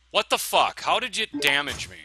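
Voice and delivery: loud, high outburst; indignant disbelief. Real, not acted. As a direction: demand an explanation from the game. Shocked at taking damage in game.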